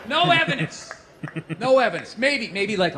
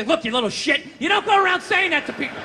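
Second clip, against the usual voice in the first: mildly raised, higher telling-off; mock scolding; 7 semitones higher than usual. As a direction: act out telling off a kid. Scolding the dream girl.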